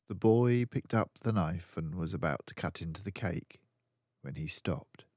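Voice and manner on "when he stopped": plain reading voice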